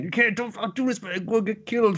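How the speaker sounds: high, pleading friend voice; voicing Einar. Friend Einar begging Thorfinn not to fight, high and pleading.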